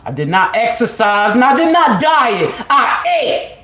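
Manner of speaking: high, loud insistence; emphatic declaration. Real, not acted. Telling doubters loudly she never dieted. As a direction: insist on every word.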